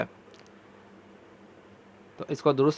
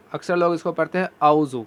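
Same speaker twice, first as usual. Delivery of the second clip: usual speaking voice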